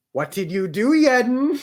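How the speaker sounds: high, loud rising outcry; exasperated outcry. Real, not acted. Reader reacting to Yedden's blunder, exasperated outcry. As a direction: cry out at Yedden in disbelief.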